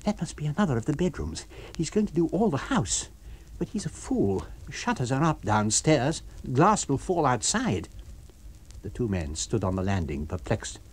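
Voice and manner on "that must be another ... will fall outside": higher, quicker character voice; lightly voiced scornful man; Kemp voice.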